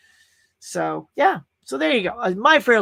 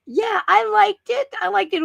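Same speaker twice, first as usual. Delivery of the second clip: mock high voice; 4 dB louder than usual. Mock-shrill delighted reaction.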